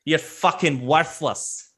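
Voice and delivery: put-on accent, scornful, slowing on the last insult; belligerent drunk dwarf. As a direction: insult them like a brawler spoiling for a fight. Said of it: "He's like".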